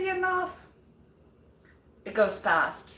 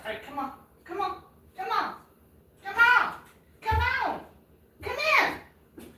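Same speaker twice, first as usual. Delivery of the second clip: climbing higher with each come on; coaxing the dog; 12 semitones higher than usual. Real, not acted. Owner coaxing her dog to come inside, rising coaxing call. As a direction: sweet-talk the dog through the door.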